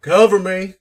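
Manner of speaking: sudden loud, high call; in-character shout. Acted. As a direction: shout it out to your partners. Player calls out as character.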